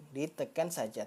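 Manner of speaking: ordinary talk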